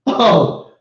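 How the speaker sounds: ordinary delivery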